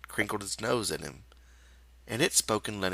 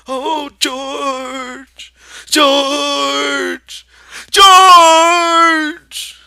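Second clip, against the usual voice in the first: high, building to a very loud call; crying out; 18 dB louder, much slower than usual. Acted. Lenny cries out for George, building to a yell. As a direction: call out louder each time. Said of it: "And he cried".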